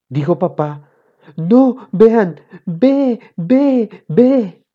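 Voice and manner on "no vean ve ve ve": put-on high, loud baby talk; high-pitched mock infant voice; pretend baby babble. A narrator's reading. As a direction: insist in baby syllables. Boy insists he can only babble.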